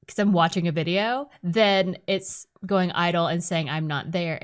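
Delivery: ordinary talk, nothing marked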